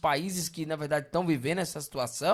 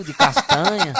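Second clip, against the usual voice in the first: laughing; 8 semitones higher, 8 dB louder than usual. Retold or imitated. Mocking vegan barbecue joke.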